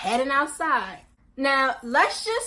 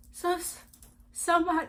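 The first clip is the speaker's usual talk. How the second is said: holding back tears; 5 semitones higher, 5 dB quieter than usual. Retold or imitated. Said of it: "'cause I'm trying not to cry".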